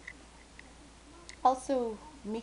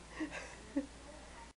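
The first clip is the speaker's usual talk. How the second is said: quiet unvoiced chuckle; a little breath of a laugh; soft breathy laugh; 9 semitones higher, 8 dB quieter than usual. Real, not acted. Laughing at her own remark, soft breathy chuckle.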